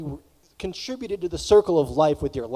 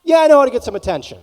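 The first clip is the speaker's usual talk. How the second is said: eager disgruntled student; 7 semitones higher, 9 dB louder, much faster than usual. Retold or imitated. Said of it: "and think".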